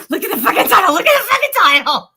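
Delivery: high, loud, laughing outburst; hysterical amusement. Real, not acted. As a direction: cry it out, cracking up. Cracking up over own title.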